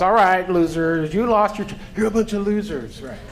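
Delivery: hammed-up drill sergeant; mock drill sergeant. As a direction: tease the room like a gruff coach.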